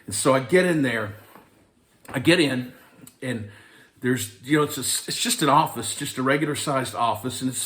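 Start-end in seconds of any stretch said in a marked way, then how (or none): none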